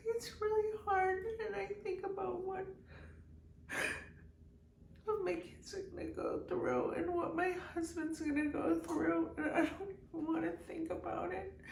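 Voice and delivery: high, soft voice choked with real tears; crying. Real, not acted. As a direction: struggle to get words out while crying. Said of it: "here I am crying on these videos".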